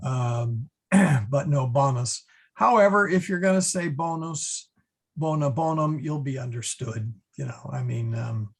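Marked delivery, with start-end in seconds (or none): 1.7-2.2 bonus with a short open O. Shown as a demonstration. Teacher restating the correct Latin form, short O. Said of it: "so it should be". 3.9-6.0 bonus, bona bonum with long O, BOH-. Shown as a demonstration. Teacher allowing the form you'll be understood with, long O. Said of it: "but it is a short, short O".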